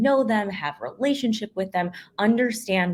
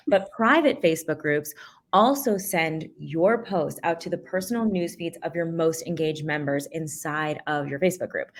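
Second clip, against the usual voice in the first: ordinary delivery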